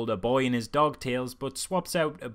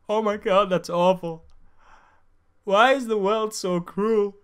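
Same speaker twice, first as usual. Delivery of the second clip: loud, high-pitched hammed-up wail; mock crying; 7 semitones higher, 7 dB louder, slower than usual. Viewer suddenly overwhelmed by the sad film, mock crying. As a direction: overact a heartbroken outburst, slowing at the end.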